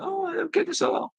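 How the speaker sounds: very high mock-whiny falsetto; crybaby student. Student in denial complaining to coach, high crybaby mockery. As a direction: imitate a squeaky crybaby. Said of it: "He's like a crybaby Charles Manson".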